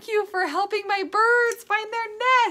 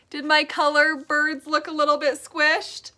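plain reading voice

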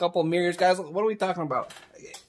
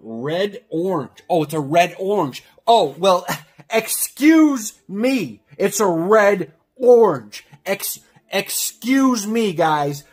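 sarcastic apology; 5 dB louder than usual. Acted. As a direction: overdo the offended "excuse me". Mock apology for wrong colour.

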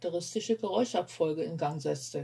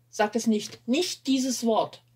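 louder, slow and drawn out, every word pressed; urgent plea; 4 semitones higher, 6 dB louder, slower than usual. Acted. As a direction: beg her, word by word, not to say it. Martin dreads the word doctor.

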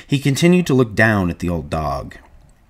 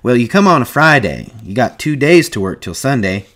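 higher, louder, amused explanation; 5 semitones higher, 6 dB louder than usual. A narrator's reading. Whit teasing George about his arrival day, amused. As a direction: point it out as a joke on the newcomer. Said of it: "Whit laughed".

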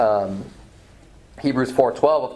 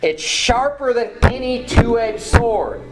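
slow, high-pitched emphatic reading; preacher slowly driving the verse home; read slower; 8 semitones higher, slower than usual. Shown as a demonstration. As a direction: stretch the phrase out with raised pitch. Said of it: "I'm gonna read that slower".

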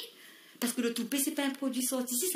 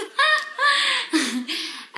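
giddy high laugh; 14 semitones higher, 6 dB louder than usual. Acted.

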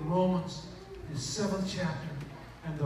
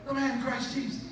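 normal talking voice

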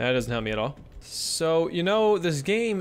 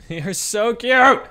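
loud, slow gush; 8 semitones higher, 9 dB louder, much slower than usual. Real, not acted. Gushing over the cuddling cubs, loud and slow. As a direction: squeal it over something adorable.

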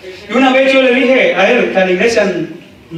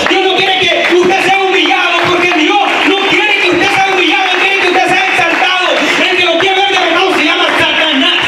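high, sustained revival shout; impassioned preacher at peak intensity; full-force preaching; 10 semitones higher than usual. Real, not acted. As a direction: proclaim it to the church with high-pitched fervour.

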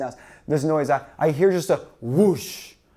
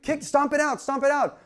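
1 urgent instructions; 9 semitones higher than usual. Retold or imitated. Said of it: "And he's like".

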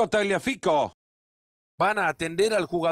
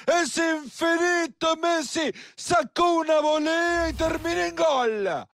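shouted goal call; 9 semitones higher, slower than usual. Acted. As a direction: roar the goal, stretch it out.

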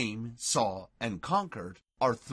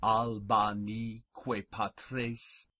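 slow, deliberate Latin, phrase by phrase with pauses; measured pronunciation model, unhurried and carefully spaced; very slow recitation. Shown as a demonstration. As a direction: recite very slowly so learners can follow along. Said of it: "I'll go very slowly".

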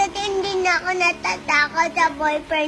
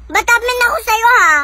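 loud, raised-pitch challenge; defiant; 4 semitones higher, 10 dB louder than usual. Real, not acted. Child answering back to a scolding relative, loud defiance. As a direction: stand up to them loudly and crossly. Child defies adult in quarrel.

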